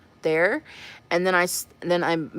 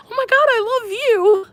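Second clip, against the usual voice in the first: much higher, louder fangirl squeal; excited squeal; 6 dB louder than usual. Retold or imitated. Answering a fan in the store, high excited squeal. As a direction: squeal it back like a starstruck fan. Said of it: "I'm like".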